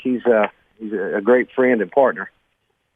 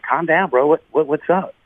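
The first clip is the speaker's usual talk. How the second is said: higher, urgent calming voice; calming a frantic caller; 6 semitones higher than usual. Retold or imitated. Retelling how he calmed a frantic caller, higher and urgent. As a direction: talk a panicked man down. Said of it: "And I'm like".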